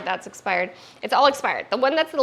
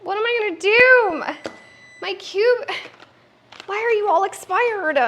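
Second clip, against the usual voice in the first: high, loud mock despair; high whiny dismay; 11 semitones higher, 4 dB louder than usual. Real, not acted. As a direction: whine at the expired cubes. Dismay at expired cubes.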